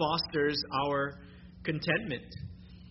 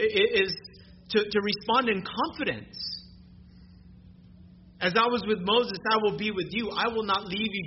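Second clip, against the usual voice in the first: raised and louder, fervent proclamation; full-force preaching; 5 semitones higher, 4 dB louder than usual. Acted. Preacher assuring the church of God's presence, raised and loud. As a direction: preach it out with conviction.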